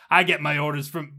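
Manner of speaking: loud, indignant officer; barking back. A narrator's reading. Agent protests to the drone, barking back until cut off. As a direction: bark it out like an affronted agent. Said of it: "he, he barks back".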